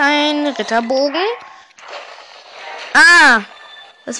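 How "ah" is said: sharp startled cry; startled yell. Real, not acted. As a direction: yelp in sudden fright. Sudden attack in game.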